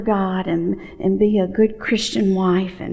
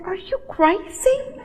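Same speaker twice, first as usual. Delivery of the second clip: husband's disbelief; slower than usual. Retold or imitated. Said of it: "He said".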